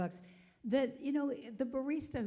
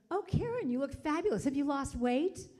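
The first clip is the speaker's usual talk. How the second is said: gushing; 4 semitones higher, 6 dB louder than usual. Retold or imitated. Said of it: "and she goes".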